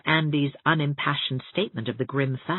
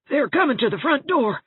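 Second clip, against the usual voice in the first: high, louder panicked housekeeper; alarmed cry, pitched up high; panic-stricken gasp; 10 semitones higher, 3 dB louder than usual. A narrator's reading. Rebecca Dew sees the Pringles arrive, gasping in panic. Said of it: "gasped Rebecca Dew, panic-stricken".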